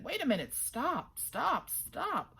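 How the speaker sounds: high calls to stop; urgent high stop cries; calling out. A narrator's reading. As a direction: call him to halt. Man calling out to runner.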